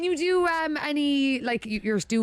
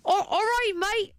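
high, clumsy Australian try; badly faked Australian, pitched up; attempted Australian accent; 7 semitones higher than usual. Shown as a demonstration. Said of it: "Do you swear that you trying?".

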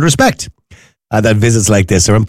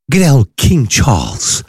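higher, put-on English accent for the royal news; posh English accent; 8 semitones higher than usual. Shown as a demonstration. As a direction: read it like a plummy English newsreader. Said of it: "Do you like my nice English accent?".

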